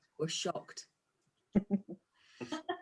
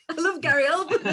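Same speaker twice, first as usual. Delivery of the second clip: laughing correction; 6 semitones higher, 5 dB louder, faster than usual. Real, not acted. Corrected name while laughing.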